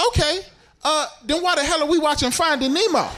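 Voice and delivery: high-pitched, exasperated challenge to a friend; mock incredulous. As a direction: question it in hammed-up disbelief. Acting his incredulous reply to Jeff.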